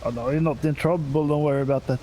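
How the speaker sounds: ordinary talk, nothing marked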